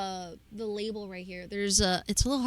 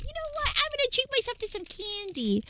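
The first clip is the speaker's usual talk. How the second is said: excited inner voice; 14 semitones higher than usual. Retold or imitated. Retold own tired thought.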